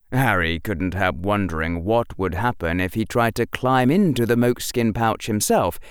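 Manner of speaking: ordinary delivery, nothing marked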